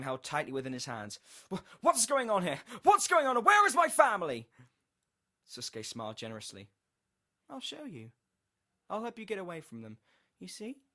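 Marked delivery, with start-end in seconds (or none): 1.5-4.5 loud, high frantic questioning; desperate scream for answers; panicked shouting. A narrator's reading. Panicked detective demands answers. 7.5-10.8 high-pitched, soothing enticement; eerie high coaxing; coaxing sinister stranger. A narrator's reading. Susuke coaxes the detective.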